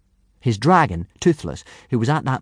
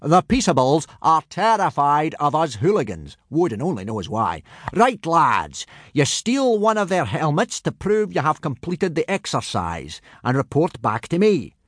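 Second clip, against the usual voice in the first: raised, hearty teacher's voice; booming Viking instructor; 7 semitones higher than usual. Acted. Gobber briefing boys on boarding boats, hearty boom. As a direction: lecture the recruits in a high, booming character voice.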